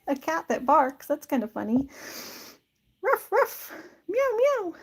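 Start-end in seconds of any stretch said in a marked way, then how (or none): 3.0-3.7 high little mock barks; imitated barking. Joking about a cat that barks, high mock barking. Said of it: "She, like, barks". 4.1-4.7 high little mock meows; imitated meow. Joking about cats meowing or barking, high mock meows. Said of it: "your cat can meow".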